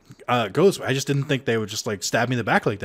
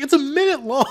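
high laughing retort; laughing disbelief; 14 semitones higher, 5 dB louder than usual. Retold or imitated. Teasing friend who skipped the video, high and laughing.

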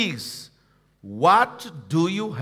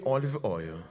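said low and soft, OLIVE OIL just murmured; soft call-and-response; 7 semitones lower, 7 dB quieter than usual. Shown as a demonstration. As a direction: model it gently for the congregation. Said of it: "Everyone say with me".

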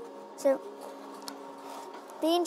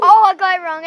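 loud dismay; 4 semitones higher, 14 dB louder, faster than usual. Real, not acted. Lost the round, exclaims.

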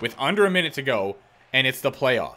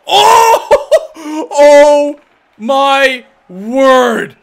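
screaming, much higher, laughing in disbelief; ecstatic shock; 12 semitones higher, 14 dB louder, much slower than usual. Real, not acted. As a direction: explode with astonished joy. Stunned by incredible save.

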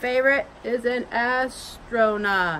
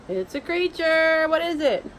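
excited wondering; 6 semitones higher, 3 dB louder than usual. Pretend. Excited guessing over drawing.

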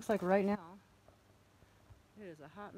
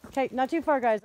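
loud, higher call to the dog; 4 semitones higher, 11 dB louder than usual. Real, not acted. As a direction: call Jack back to the path. Calling the dogs.

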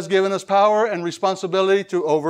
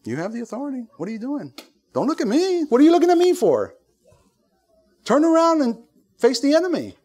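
exasperated God; 7 semitones higher than usual. Retold or imitated. God tells the believer to face the enemy, exasperated. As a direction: act out the Lord's exasperated rebuke, high and insistent. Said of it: "He's like this".

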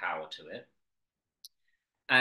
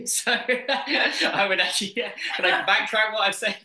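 laughing; 12 semitones higher, 10 dB louder than usual. Real, not acted. Laughs at being caught out.

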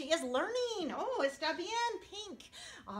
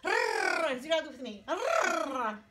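Rrr trilled long and loud; rolled R; 5 dB louder, much slower than usual. Shown as a demonstration. Teacher demonstrating the rolled R. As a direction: roll the R for the kids. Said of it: "roll those two R's together".

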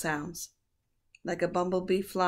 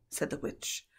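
ordinary delivery

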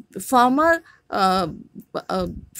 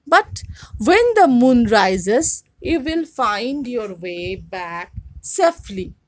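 raised, lively read-aloud voice for the class; story reading; 3 semitones higher, 6 dB louder than usual. A narrator's reading. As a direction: read the folktale out to students with expression. Teacher reads story aloud.

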